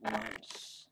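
quiet whispered menace; unhinged villain. Acted. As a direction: plot murder in a whisper.

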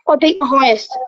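ordinary talk

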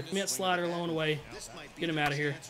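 normal talking voice

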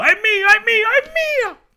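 very high, loud squabbling cries; shouting chase. Acted. The frogs chase Gianni for the worm, loud high shouting. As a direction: scream it while chasing. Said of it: "lo rincorsero gridando".

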